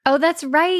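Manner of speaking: usual speaking voice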